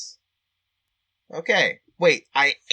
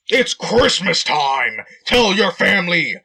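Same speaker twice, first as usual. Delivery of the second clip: slow, loud Santa shout; 6 dB louder, slower than usual. Acted. Kyle shouting at the stopped car, loud and slow. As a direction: bellow it at the driver. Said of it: "Kyle shouted".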